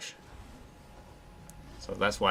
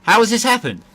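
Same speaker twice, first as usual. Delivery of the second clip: much higher, much louder cry of disbelief; outraged shout; 12 semitones higher, 12 dB louder than usual. Real, not acted. Gamer screaming after being overtaken at the end.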